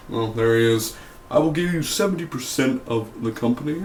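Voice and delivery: lightly voiced smooth businessman offer; a loose attempt at Joe's confident pitch. Reviewer half-heartedly voicing Joe making his offer. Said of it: "I can't do that Joe voice".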